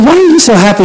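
high-pitched, acted-out question; exaggerated questioning. Teacher asks overjoyed girl for the reason, exaggerated questioning.

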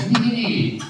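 high-pitched "nee, nee, nee"; mock objection. House group members correcting the preacher, high mock protest. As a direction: jump up high to wave the idea away. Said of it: "sagten mir Leute aus dem Kreis".

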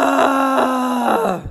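high exasperated yell of "Ah!"; frustrated shout. Real, not acted. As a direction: let the frustration burst out. Frustrated cry after meltdown story.

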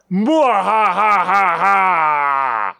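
exaggerated slow evil cackle; mad scientist. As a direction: lean back and cackle like a maniac. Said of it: "Leans back in exaggeration".

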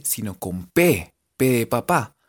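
normal talking voice

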